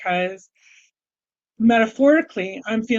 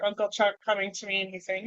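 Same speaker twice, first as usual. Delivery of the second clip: ordinary talk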